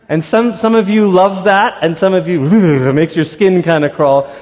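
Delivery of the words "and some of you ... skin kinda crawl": usual speaking voice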